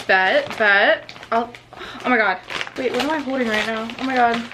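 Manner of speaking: ordinary delivery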